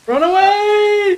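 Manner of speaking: high, slow, drawn-out wail; hammed-up shriek. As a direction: wail it like a cartoon coward. Out of ammo, fleeing.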